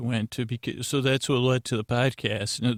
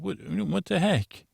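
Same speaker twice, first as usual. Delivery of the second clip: higher, bemused outburst at usual volume; lightly acted disbelief, pitch lifted; mock exasperation; 6 semitones higher than usual. Host recalls wondering why no bedtime stories, mock exasperated higher voice. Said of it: "And I said".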